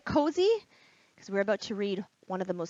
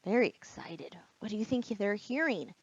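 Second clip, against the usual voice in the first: whisper; 5 dB quieter than usual. Real, not acted. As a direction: whisper the question to draw them in. Aside to children.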